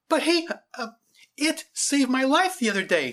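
put-on high, loud child's protest; crying out. A narrator's reading. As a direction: cry out high and urgent, as a young girl. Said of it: "she cried out".

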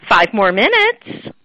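stretched-out classroom countdown; nice teacher reminder. Retold or imitated. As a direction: announce it like a patient teacher. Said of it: "our nice teacher thing".

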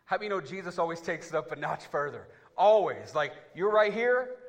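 loud, rising preacher's call; building preacher's shout; fired-up preaching. Acted. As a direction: stir up the church. Preaching at full force.

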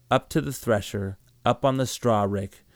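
plain reading voice

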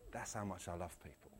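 very soft, low reply; tender. Acted. Preacher voicing Jesus's answer on love, tender and hushed. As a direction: drop right down, quiet and sincere.

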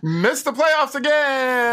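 high, slow gloating taunt; mocking taunt. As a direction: rub it in slowly. Mock sports taunt.

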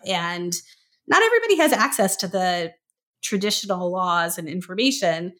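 ordinary talk

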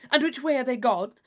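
eager loud question to a friend; hasty eager question. Acted. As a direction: blurt it out, turning quickly. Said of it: "turning hastily around".

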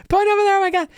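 very high frantic squeal; panicked shriek. Acted. Screaming Sniffles pointing past the players, panicked. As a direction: act out a terrified creature.

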